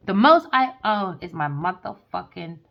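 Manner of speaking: normal talking voice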